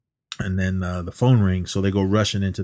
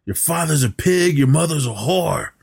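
mock angry caller; 7 semitones higher than usual. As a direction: spit the insult out like the creep caller. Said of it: "he seemed to get super angry".

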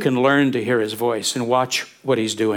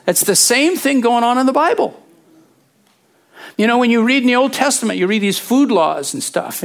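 ordinary delivery

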